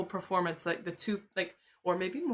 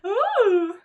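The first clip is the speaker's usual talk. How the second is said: high, loud delighted coo; delighted ooh; 13 semitones higher, 9 dB louder than usual. Real, not acted. Seeing the girlfriend's photo.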